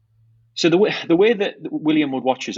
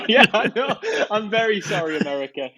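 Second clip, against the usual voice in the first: laughing; 11 semitones higher than usual. Real, not acted. Laughing at own bad accent.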